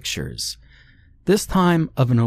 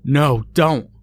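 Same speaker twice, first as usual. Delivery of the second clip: higher-pitched acted plea; desperate cry out; 6 semitones higher, 4 dB louder than usual. A narrator's reading. Large man begs thug raising a machete, crying out. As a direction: beg the attacker to stop, crying out. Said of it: "the large man managed to cry out".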